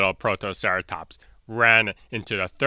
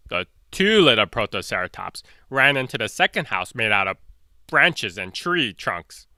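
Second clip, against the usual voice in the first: ordinary delivery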